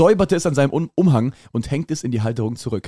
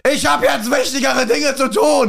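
shouting; 10 semitones higher than usual. Acted. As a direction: scream it at the servant. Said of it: "schrie Licious".

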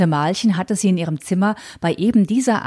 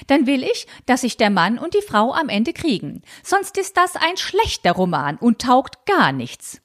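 put-on prim old aunt; 2 semitones higher than usual. Tante Malchen voiced in reading.

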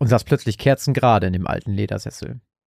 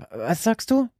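confused grumble; 12 semitones higher, slower than usual. A narrator's reading. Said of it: "brummte Frank etwas verwirrt".

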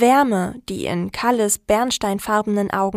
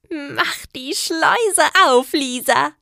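higher, drawn-out call; eager command; 6 semitones higher, slower than usual. A narrator's reading. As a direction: stretch it out, impatient.

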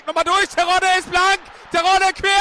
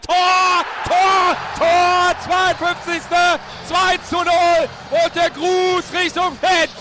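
louder roaring goal call; screaming goal commentary; 4 dB louder, much slower than usual. Real, not acted. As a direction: scream the goal, then draw it out. Radio goal call, shouting.

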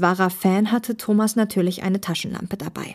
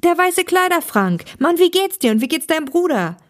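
put-on high, excited hello to an old friend; thrilled fan greeting his idol; delighted greeting; 9 semitones higher, 4 dB louder than usual. A narrator's reading. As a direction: gush happily, pitched well up. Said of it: "rief Thomas erfreut aus".

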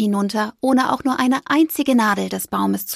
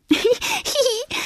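high little hihi giggle; giggling mouse. A narrator's reading. Mouse giggling at the stuffed-cheeked squirrel. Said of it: "sagte Fipper".

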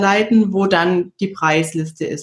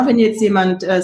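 ordinary delivery

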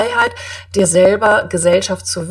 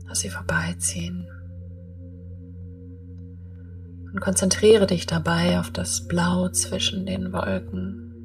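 guided meditation; 9 dB quieter than usual. Acted.

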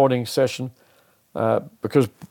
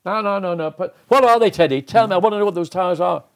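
retold demand, pitched higher and pressing; insistent questioning; 5 semitones higher than usual. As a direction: keep pushing Teddy for an answer. Arguing with Teddy in the garage.

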